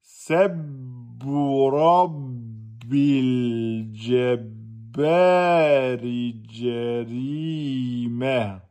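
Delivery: every syllable drawn out, very slow; slow motion. Shown as a demonstration. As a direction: stretch each sound like slow motion. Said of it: "je vous fais en slow motion". (au ralenti, chaque syllabe étirée)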